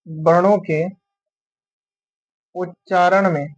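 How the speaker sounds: slow, spaced-out dictation with long pauses; teacher dictating notes slowly. Acted. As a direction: read each phrase for students to copy down. Dictating a definition.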